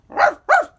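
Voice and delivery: loud, high little yap like an excited dog; high yappy dog bark. Acted. Krypto the dog yapping at his owner, loud high bark.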